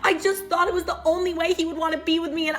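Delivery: high, whiny imitation of sobbed apology; mock tearful. Imitating sister confessing in tears, high mock crying. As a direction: wail it mockingly, dissolving into babble. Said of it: "she just bursts into tears".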